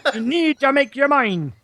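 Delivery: Scottish accent. Shown as a demonstration. Host trying song lyrics in Scottish, comic Scottish accent. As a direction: give the lyrics a playful Scottish lilt. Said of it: "do it with a Scottish accent".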